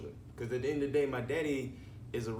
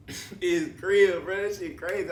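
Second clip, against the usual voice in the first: laughing; 7 semitones higher, 7 dB louder than usual. Real, not acted. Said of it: "I'm still, like, laughing at it".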